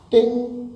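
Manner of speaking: a high, bright bell-like ding; ding sound. Pretend. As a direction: ring it out like a quiz bell. Marks a key word playfully.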